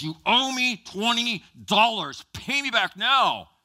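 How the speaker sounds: slow, high, loud acted fury; angry demand. Preacher plays servant grabbing his debtor, angry loud demand.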